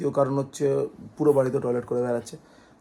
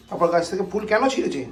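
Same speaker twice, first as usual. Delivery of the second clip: sharp, higher, louder telling-off; a pet owner's raised reproach; scolding the dog; 6 semitones higher, 6 dB louder than usual. Real, not acted. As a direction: scold him for tearing the flowers. Scolding the dog over flowers.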